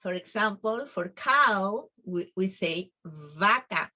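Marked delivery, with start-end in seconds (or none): 3.0-3.9 "vaca" begun with a true V; labiodental V. Shown as a demonstration. Teacher gives the word for cow, "vaca" with a true V. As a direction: teeth on lip for the V in "vaca". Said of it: "That is how you need to pronounce".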